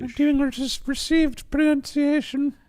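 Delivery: very high, slow, loud RP; Queen's English. Acted. Podcaster demonstrating Queen's English, hammed-up RP. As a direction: draw it out in exaggerated received pronunciation. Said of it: "Like the Queen's English".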